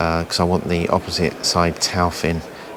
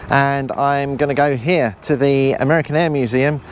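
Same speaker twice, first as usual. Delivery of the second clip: raised, higher voice outdoors; shouting over wind; 7 semitones higher than usual. Real, not acted. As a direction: project over the wind noise. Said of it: "I hope you can hear me over the wind noise".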